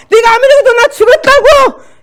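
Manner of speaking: high, full-force defiant scream. Retold or imitated. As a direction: shriek it back at the devil. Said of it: "난 비명을 지르면서".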